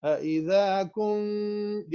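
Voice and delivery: slow melodic tajweed recitation; Quran recitation. Shown as a demonstration. Teacher reciting the verse for his students. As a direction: chant the verse slowly with nasal ghunnah. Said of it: "سو میں پوری آیت کو پڑھتا ہوں".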